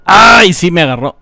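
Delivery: ordinary delivery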